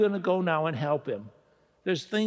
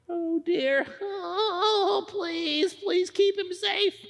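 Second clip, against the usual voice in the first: anxious prayer; 10 semitones higher, much slower than usual. Acted. Praying for friend's safety.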